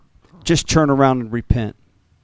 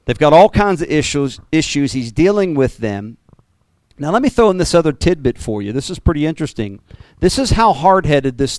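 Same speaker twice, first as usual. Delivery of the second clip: ordinary talk, nothing marked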